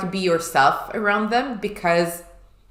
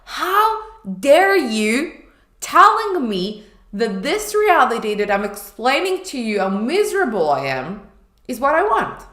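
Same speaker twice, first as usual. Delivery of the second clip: retold furious protest, higher and louder; angry retort; 5 semitones higher, 5 dB louder than usual. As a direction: fling the accusation back at her. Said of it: "I was angry".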